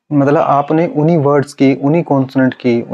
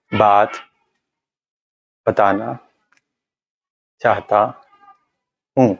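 Hello sir, मैं आपको कुछ बात बताना चाहता हूँ, with a long pause after every word; slow word-by-word sentence with long pauses; pause technique; 2 semitones lower, slower than usual. Shown as a demonstration. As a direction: say one word, pause, then the next. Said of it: "इसमें pause technique भी आ जाती है".

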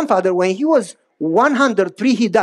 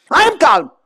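high, louder snap insisting he's calm; irritated denial; 7 semitones higher, 5 dB louder than usual. Retold or imitated. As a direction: bite back, clearly not calm. Snapping back at wife's calm down.

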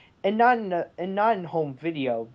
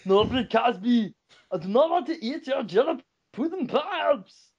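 hammy pudding-ad voice; impersonation; 5 semitones higher than usual. Pretend. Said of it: "guest impersonation".

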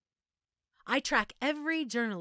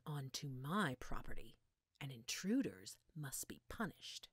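softer threatening whisper; menacing whisper; 5 semitones lower, 9 dB quieter than usual. Acted. Scientist to the thief she caught, threatening whisper. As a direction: whisper the threat, close and cold.

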